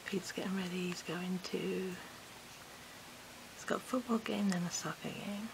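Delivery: soft unvoiced whisper so nobody wakes; hushed early-morning whisper. Real, not acted. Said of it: "I feel like I have to whisper".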